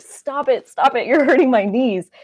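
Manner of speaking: high, loud pleading yelp; pleading protest. Retold or imitated. Speaker at lunch to family telling pain story, high loud plea. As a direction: beg them to stop, voice jumping up. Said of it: "and I was like".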